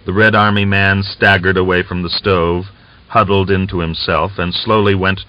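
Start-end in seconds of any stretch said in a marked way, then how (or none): none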